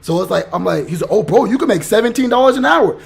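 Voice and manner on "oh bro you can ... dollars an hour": high, loud smug quote of the recruiter; a touch of a smug recruiter bragging; mocking his tone. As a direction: mimic a smug guy bragging about the pay. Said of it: "This is the exact tone he was using".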